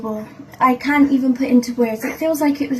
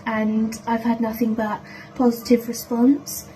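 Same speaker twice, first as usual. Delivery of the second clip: ordinary talk, nothing marked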